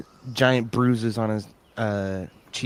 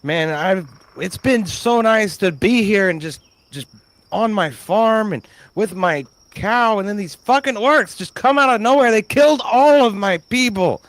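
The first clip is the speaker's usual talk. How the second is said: high, loud, upset lament; upset farmer; 11 semitones higher, 7 dB louder than usual. Acted. Farmer telling rescuers the orcs killed his people, high upset lament. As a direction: pour out the grief of a ruined farmer. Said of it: "he's like".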